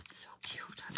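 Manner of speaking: whispered to herself; breathy aside, admiring the set; soft whisper, just for herself. Real, not acted.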